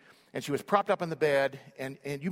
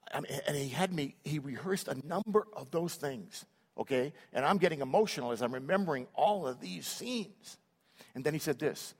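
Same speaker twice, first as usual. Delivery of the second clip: ordinary talk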